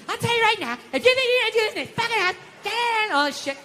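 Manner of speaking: high, rapid-fire telling-off; acted-out wife's rant, high and furious; angry wife's tirade.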